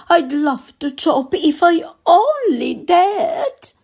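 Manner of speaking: high, frightened cook voice; scared cook. Acted. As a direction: squeak out the cook's fear, pitched high.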